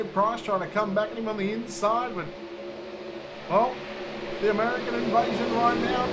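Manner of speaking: energetic motorsport race call; live race play-by-play. Real, not acted. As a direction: call the race live, surging at passes and contact.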